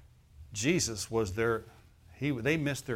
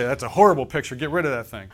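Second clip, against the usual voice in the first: mock kids complaining; 9 dB louder, faster than usual. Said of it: "then the kids will say".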